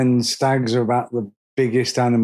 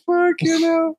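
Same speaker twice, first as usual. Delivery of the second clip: panicked yelp. Retold or imitated. Retold scream at spider.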